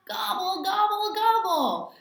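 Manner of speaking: light mock turkey call; turkey gobble. Turkey sound in story.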